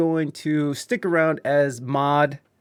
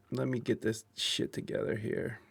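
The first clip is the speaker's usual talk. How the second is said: muttered whisper; frustrated whisper to self; 14 dB quieter than usual. Real, not acted. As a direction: whisper it under your breath while fixing things. Fixing broken stream setup.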